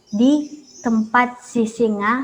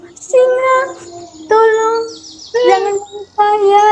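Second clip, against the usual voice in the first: high, pleading mouse voice, hammed-up whimper; story mouse begging the lion, mock crying; crying little mouse; 10 semitones higher, slower than usual. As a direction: beg the lion like a tearful child. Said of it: "dia pun menangis".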